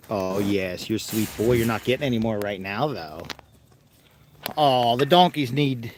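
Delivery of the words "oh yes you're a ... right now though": soft pet talk. Real, not acted. Praising the animal, then refusing more treats.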